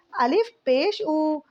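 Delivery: each syllable drawn out slowly; slow, deliberate teacher's reading; slow spelling drill. Shown as a demonstration. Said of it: "تو اس کو بولتے ہیں پیش".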